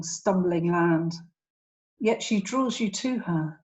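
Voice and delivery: measured poem recital with long pauses between phrases; poetry reading. Acted. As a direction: read it as verse, lingering on each image. Reads own poem aloud.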